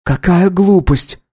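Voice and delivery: slow, high indignant voice. A narrator's reading. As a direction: scold it, outraged. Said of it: "Баджи возмутилась".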